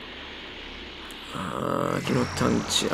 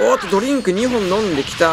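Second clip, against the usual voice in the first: excited; 6 semitones higher, 5 dB louder than usual. Real, not acted. Excited commentary.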